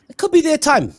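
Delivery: sudden high, loud burst; excited. Real, not acted. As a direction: belt it out like a fan's hope. Hyped prediction about the team.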